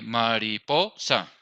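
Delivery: slow, drawn-out mariposa; "mariposa" said slowly for counting syllables; syllable demo. Shown as a demonstration. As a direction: stretch out each part of "mariposa". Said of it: "Entonces, mariposa".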